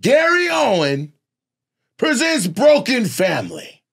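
high, booming show announcement; hype man. Shown as a demonstration. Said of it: "I'll be your hype man".

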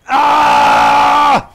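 sharp high yelp; startled scream. Real, not acted. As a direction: scream in shock. Sudden scream.

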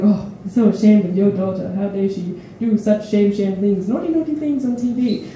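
tut-tut like a shocked community elder; mock disapproving elder. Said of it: "confronting my family at the temple going".

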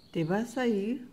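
ordinary talk, nothing marked

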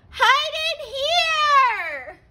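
high, slow call of a small pig; urgent little pig. A narrator's reading. Pig urging brothers into the house, high. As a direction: draw each word out high to hurry them in. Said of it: "cried the third little pig".

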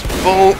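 loud high mock gunshot "boom!"; sniper boom. Narrating his sniper movie, a loud mock boom. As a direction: blast it out like the shot lands. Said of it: "then he goes".

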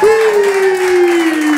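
high, loud whoop; excited whoop; crowd hype yell. Real, not acted. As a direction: whoop to pump up the room. Hyping the crowd.

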